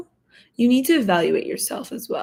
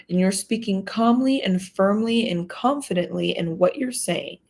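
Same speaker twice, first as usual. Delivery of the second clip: ordinary talk, nothing marked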